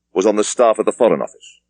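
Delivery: plain reading voice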